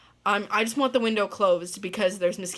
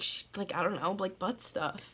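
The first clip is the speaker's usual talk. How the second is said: embarrassed mumble; 8 dB quieter than usual. Retold or imitated. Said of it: "And he's like".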